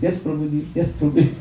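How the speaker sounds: soft, shy, deferential murmur; a hint of a timid monk agreeing humbly; quietly imitating the shy brahmachari; meek gentle yes-man. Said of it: "he would always be like this".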